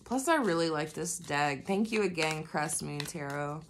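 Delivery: ordinary delivery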